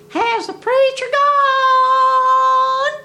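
very high, slow, loud crowing; rooster crow. Retold or imitated. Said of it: "So he hollered again".

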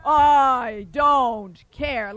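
loud giant refrain; loud defiant giant. Acted. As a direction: say it like a stubborn giant. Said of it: "And the giant said".

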